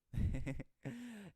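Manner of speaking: loud, high laugh. Real, not acted. Reader finishing the article, loud high laughter. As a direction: burst out laughing. Amused after reading article.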